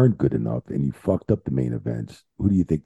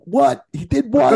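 retold shock, much higher and a bit louder; shocked disbelief; 4 dB louder, slower than usual. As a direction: act out hearing unbelievable news. Retelling shocked reaction to phone news.

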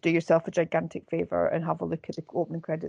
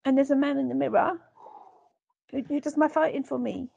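high, timid put-on Londoner; meek character impression; 6 semitones higher than usual. Co-host as the character with mirror man, high and meek. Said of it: "I talk like this".

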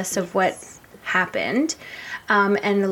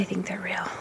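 whispering; 10 dB quieter than usual. Real, not acted. Spooky secret about hidden things.

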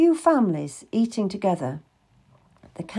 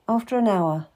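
ordinary delivery

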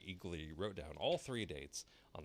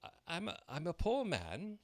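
nervous Hatter; 12 semitones higher, 5 dB louder than usual. A narrator's reading. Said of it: "the Hatter went on".

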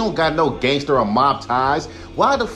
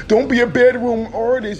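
mocking quote; 8 semitones higher, 3 dB louder than usual. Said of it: "This is why when people say".

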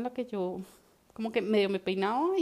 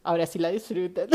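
laughing; 13 dB louder than usual. Real, not acted. Laughing at her punchline to the co-host. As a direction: laugh at your own joke.

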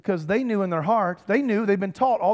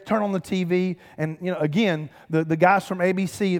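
usual speaking voice

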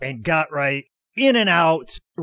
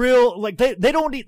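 ordinary talk, nothing marked

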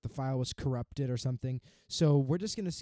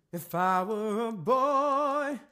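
high, slow sung woman's voice; man doing a woman's voice; 8 semitones higher, 6 dB louder, much slower than usual. Pretend. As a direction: sing it like [name], in falsetto. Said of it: "It's hard to do a woman voice".

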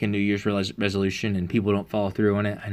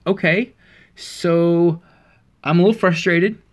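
mock frustrated anger; 6 semitones higher, 4 dB louder, slower than usual. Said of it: "but I was angry".

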